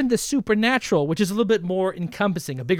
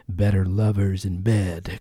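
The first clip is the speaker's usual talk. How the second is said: slow, much lower sultry crooner; seductive voice; 12 semitones lower, slower than usual. Pretend. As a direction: purr it like a cheesy seducer. Sexy punchline about being lovers.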